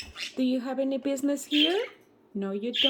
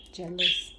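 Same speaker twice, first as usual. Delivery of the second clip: ordinary talk, nothing marked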